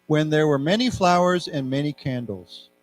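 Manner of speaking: slow, evenly spaced phrases with long pauses; read-along. Shown as a demonstration. Teacher leading students through a text, slow read-along. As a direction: read slowly, leaving room for repeats. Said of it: "So let's read this together".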